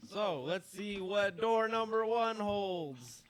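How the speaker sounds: dramatic reveal. Acted. GM reveals door contents.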